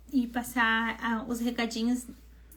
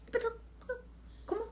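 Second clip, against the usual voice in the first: tearful protest; 9 semitones higher, 13 dB quieter, much faster than usual. Retold or imitated. Protesting, crying sound heard.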